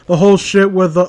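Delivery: ordinary delivery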